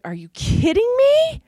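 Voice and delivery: high, loud, slow disbelief; stunned by the diagnosis; incredulous. Retold or imitated. To the doctor after the bad news, high, slow disbelief. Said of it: "I just was like".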